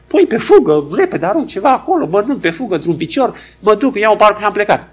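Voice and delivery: hurried woman. Retold or imitated. Stressed woman telling her Greek boss, high and rushed. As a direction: rattle it off like a woman always running.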